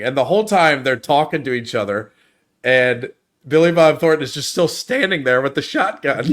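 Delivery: ordinary talk, nothing marked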